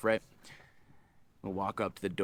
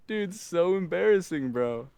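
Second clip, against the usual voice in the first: laughing cringe; 7 semitones higher, slower than usual. Real, not acted. Cringes laughing at own reply.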